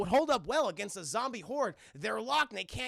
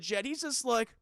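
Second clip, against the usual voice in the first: ordinary delivery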